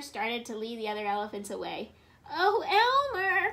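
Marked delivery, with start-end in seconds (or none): 2.3-3.5 high, loud, drawn-out name; pleading call. A narrator's reading. Wilbur calls to departing cousin, long high pleading holler. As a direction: wail after someone walking away. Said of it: "called Wilbur".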